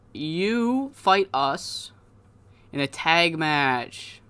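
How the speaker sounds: slow, drawn-out wrestling promo; deliberate challenge. Retold or imitated. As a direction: issue the challenge haltingly, stretching each phrase. Acts out the pair's challenge.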